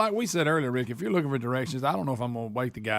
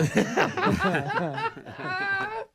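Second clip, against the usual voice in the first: high-pitched cackling laugh. Real, not acted. Host cracks up at his own cancelling joke, high laughing. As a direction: burst into a long high laugh.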